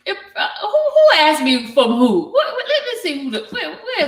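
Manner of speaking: laughing, high squeaks in between. Real, not acted. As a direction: laugh through the questions. Laughing about nosy question.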